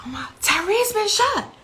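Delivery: high, mild imitation of a screaming caller; retold screaming. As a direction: relay a caller's distressed scream, held in volume. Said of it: "It was screaming and hollering".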